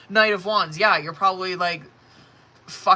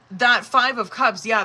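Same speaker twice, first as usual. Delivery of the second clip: ordinary talk, nothing marked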